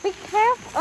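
high cautioning voice again; mock warning. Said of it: "When she tells you to be careful".

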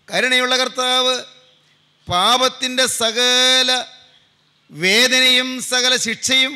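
forceful sermon on a held, sustained pitch; impassioned preaching. Real, not acted. Preacher telling believers Christ bore sin's sting, fervent proclamation. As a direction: preach it out, drawing out key words.

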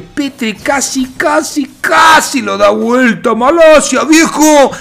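high-pitched, building intensity; worked-up commentator exclaiming; excited near-miss call. Real, not acted. Excited recap of a near comeback.

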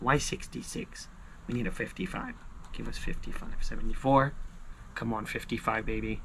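hushed, breathy urging under the breath; begging the ticket in a near whisper; whispered pleading. Real, not acted. Urging scratch numbers under breath.

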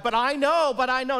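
high, loud, insistent repeat; Martha's insistence. Acted. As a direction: press the faith home. Martha's faith voiced.

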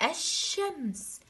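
teacher shows first-graders the sun lam, الشمس with silent l, drawn out. Shown as a demonstration. Teacher reminding pupils of the sun lam, silent l in الشمس. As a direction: say الشمس slowly, the l unsounded, straight into a long sh. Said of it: "كتبتها ولم ألفظها".

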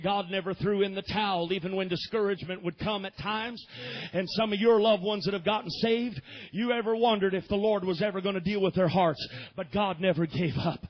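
normal talking voice

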